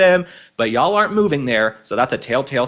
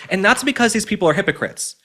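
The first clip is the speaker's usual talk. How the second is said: usual speaking voice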